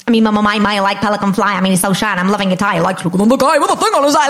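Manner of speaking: rapid rap patter, rising at the end; fast, rhythmic rapper impression. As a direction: rattle off rhymes as a quirky rapper. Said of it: "[name] came quite easily".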